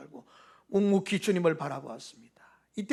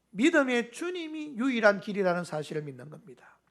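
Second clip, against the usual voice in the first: normal talking voice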